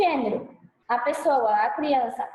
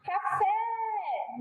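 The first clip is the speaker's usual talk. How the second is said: "Café" as a soft far-off call, high long final É; calling out the word; 5 semitones higher, 6 dB quieter than usual. Shown as a demonstration. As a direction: stretch the FÉ of "Café" as if calling your mother, voice held back. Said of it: "Finge que você tá lá chamando sua mãe, chama a palavra". (chamando "Café", com o É final agudo e alongado)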